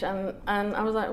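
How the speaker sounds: ordinary delivery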